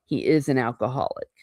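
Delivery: usual speaking voice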